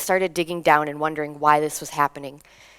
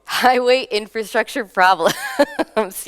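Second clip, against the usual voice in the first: laughing; 4 semitones higher, 4 dB louder, much slower than usual. Real, not acted. Repeating audience answer, amused.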